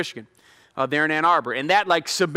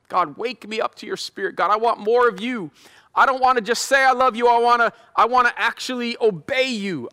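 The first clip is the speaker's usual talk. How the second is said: earnest prayer; 6 semitones higher than usual. Acted. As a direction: pray aloud, yearning for more of God. Leads a prayer.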